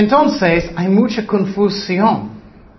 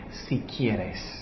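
soft, much lower, humble si quieres; humble plea; 10 semitones lower, 15 dB quieter than usual. Retold or imitated. Preacher stresses the leper's humble plea, soft and low. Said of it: "Él dijo, "¿Qué?".

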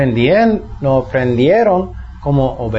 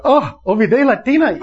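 sudden realization; 8 semitones higher than usual. Retold or imitated. Suddenly remembers the bathtub.

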